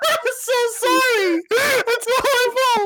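very high, squealing through laughter; helpless laughter. Real, not acted. Co-host apologising for derailing the host, helpless laughter. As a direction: apologise while losing it completely.

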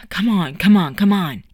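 usual speaking voice

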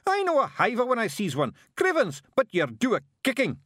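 high, loud broad Scots yell; Scottish shout. Acted. Rob in rage.

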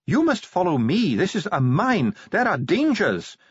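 high, insistent dwarf warning; high-pitched, emphatic dwarf character; urgent dwarf official. Acted. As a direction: warn them sternly in a high, precise voice. Dwarf catches up, warns them off.

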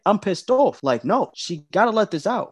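usual speaking voice

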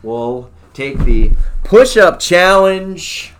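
loud, slow announcement; proud announcement. Real, not acted. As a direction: proclaim the record. Announces result.

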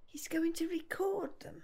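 soft whispered repetition; whispered to herself. Acted. Gran whispering to herself about her programmes. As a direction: murmur it back, trying to hold onto it.

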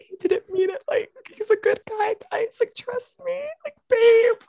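put-on high pleading girlfriend; defensive girlfriend voice. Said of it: "Leon would be like".

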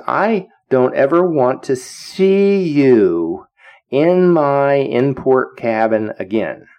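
very slow, drawn-out order; stern warning. Retold or imitated. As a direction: stretch out each word as a retold warning. Admiral banishing ensign from his cabin.

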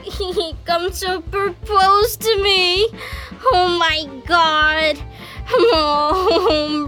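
hammed-up tearful wail; wailing plea. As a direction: moan and beg as if all is lost. Wails for suitor to return.

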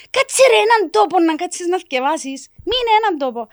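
put-on loud, high nagging yell at child; scolding mother. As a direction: snap at a fidgety kid.

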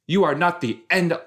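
high, loud exasperated scolding; snapping at Cleo. Retold or imitated. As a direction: shut her up, fed up. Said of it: "And it's like".

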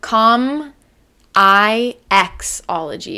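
"Com I Xology" in slow, separate pieces; "Com I Xology" with pauses before I and X; broken into parts. Shown as a demonstration. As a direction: spell out the middle of "Com I Xology", letter by letter. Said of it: "there's no C, the second C".

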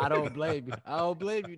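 high, loud sympathetic backup; mock sympathy. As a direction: sympathize over the top.

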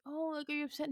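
lightly mocked sympathetic question; concerned onlookers. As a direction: imitate someone gently checking in. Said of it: "and then people are like".

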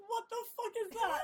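laughing imitation of a shocked partner; mock angry boyfriend. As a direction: play the boyfriend's outraged shout with a laugh in it. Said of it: "He goes".

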